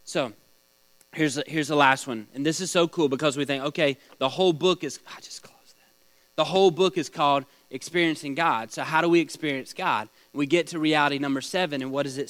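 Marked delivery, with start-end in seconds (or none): 5.1-5.9 a whispered note to himself; whispered aside. Real, not acted. Teacher whispering to himself over the closed book, a quiet aside. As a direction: breathe it under your breath.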